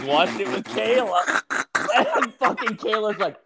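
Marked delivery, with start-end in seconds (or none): none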